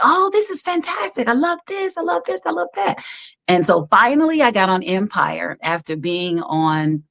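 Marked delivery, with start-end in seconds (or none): 0.0-3.0 much higher, gushing and delighted; gushing praise. Retold or imitated. Casting staff gush over her outfit in a high voice. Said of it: "they're like".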